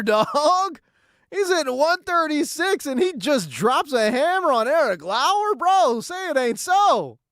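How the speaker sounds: high-pitched, incredulous sports-host hype; can't believe that hit, pitch riding high; hyped disbelief. Real, not acted. Reacting to unlikely home run.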